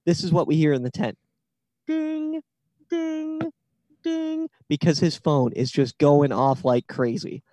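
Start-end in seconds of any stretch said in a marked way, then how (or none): 1.9-2.4 high-pitched, held "ding"; soft, high singsong alert sound; imitated phone chime. 2.9-3.4 high-pitched, held "ding"; soft, high singsong alert sound; imitated phone chime. 4.0-4.5 high-pitched, held "ding"; soft, high singsong alert sound; imitated phone chime.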